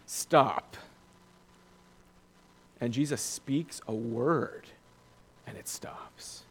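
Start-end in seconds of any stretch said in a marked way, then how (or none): 5.5-6.4 near-whispered, voiceless hush; very soft, awed-sounding whisper. Real, not acted. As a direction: drop to a whisper for effect. Hushed climax after storm is stilled.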